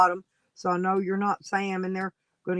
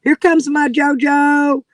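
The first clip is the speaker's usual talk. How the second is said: loud, high, drawn-out cheer; excited welcome; 6 semitones higher, 11 dB louder, slower than usual. Real, not acted. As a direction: announce a favourite guest's arrival, loud and slow. Host cheers guest's arrival on stream.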